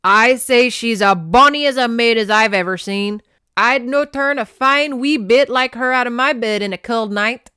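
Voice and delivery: booming amateur Scottish brogue; loud old Scotsman, badly faked accent. A narrator's reading. Clan elder praising the lass to his men, hammy Scottish accent. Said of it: "I can't do a Scottish accent".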